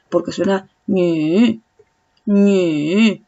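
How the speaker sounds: teacher sounds the third tone. Shown as a demonstration. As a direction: let ñu sink into the dip. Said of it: "es hacia abajo".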